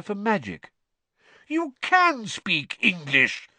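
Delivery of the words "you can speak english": high, slow, indignant exclamation; affronted, raised-pitch disbelief; outraged. A narrator's reading. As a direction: accuse in shocked outrage. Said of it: "sounding outraged".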